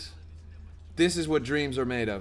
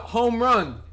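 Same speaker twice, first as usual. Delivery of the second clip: ordinary delivery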